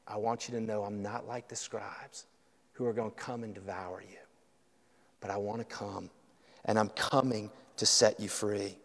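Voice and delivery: low, very soft words of Jesus; tender reassurance. Acted. Preacher voicing Jesus to flawed followers, tenderly reassuring. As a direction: reassure gently, well under full voice.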